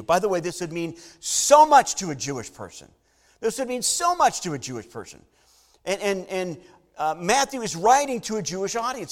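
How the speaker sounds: loud, ringing preacher voice, driving each line home; a pastor proclaiming the promise to David, loud and fervent; preaching at full force. Acted. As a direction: preach it to the back pews.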